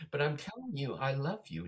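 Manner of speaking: high, pleading insistence; mock earnest appeal; insistent preacher imitation. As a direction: plead like a preacher who won't stop.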